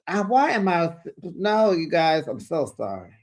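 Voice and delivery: normal talking voice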